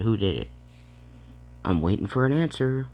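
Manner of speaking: demanding. Real, not acted. Demanding answer from viewers.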